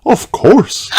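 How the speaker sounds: a kind mum agreeing; Mummy Pig. A narrator's reading. Mummy Pig answering Peppa, lightly voiced mother.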